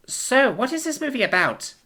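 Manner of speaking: high-pitched alien newcomer asking politely; Ima's voice. A narrator's reading. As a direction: lift into a high, careful young alien voice. Ima's voice in the story.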